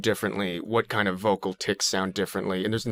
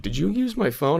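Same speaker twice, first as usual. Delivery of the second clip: grandfather voice; 7 semitones higher than usual. Retold or imitated. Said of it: "my grandfather would be like".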